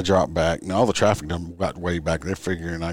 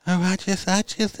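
much higher mocking squeak of a flustered girl; mock flustered driver; 13 semitones higher than usual. Officer mimicking the young driver's excuse, high mock squeak. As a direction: stammer it out in a silly high put-on voice.

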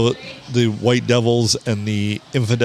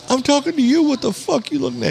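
much higher, insistent retold challenge; confrontational stranger. Gigantic guy challenges the friend for staring, high-pitched indignation. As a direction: imitate a riled guy demanding an answer. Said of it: "the guy goes".

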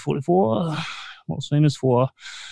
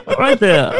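laughing; 5 semitones higher than usual. Real, not acted. Laughs at own singing.

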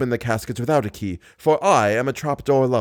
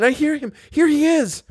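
very high heroine's voice; Christine crying out. A narrator's reading. Christine hears him arriving, high-pitched cry. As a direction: call out high, climbing higher. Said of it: "cried Christine".

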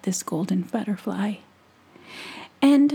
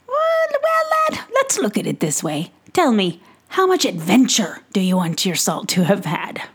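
louder, hearty merchant voice; jolly pixie peddler; 4 dB louder than usual. Acted. Peddler teasing young Tom about salt, hearty and louder. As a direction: sell it like a showman at his cart.

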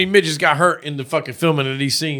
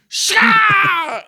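mock battle cry; 14 semitones higher than usual.

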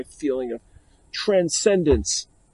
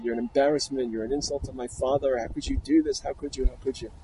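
usual speaking voice

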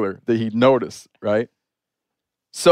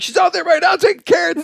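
yelling down. Retold or imitated. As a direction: call it down the stairs so the whole house hears. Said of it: "And I yell down".

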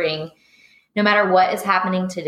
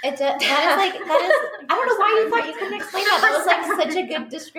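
high, fast laughing through emotion; laughing and teary; 11 semitones higher than usual. Real, not acted. Host moved by the guest's answer, laughing high. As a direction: gush through laughter, much higher and quicker. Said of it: "Now we're all crying".

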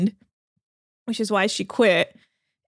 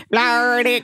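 mock Australian accent. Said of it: "that's what they all sound like".

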